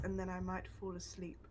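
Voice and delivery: ordinary delivery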